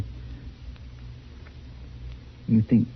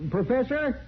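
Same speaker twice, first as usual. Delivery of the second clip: calling a name high across the open prairie, voice raised in pitch; 13 semitones higher, 7 dB quieter than usual. Acted. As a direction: call out into the dark, searching and unsure. Searching for him, calling out.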